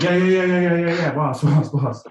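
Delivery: rowdy Cockney chant of yeah and boss; mock Cockney cheer. Acted Cockney crowd.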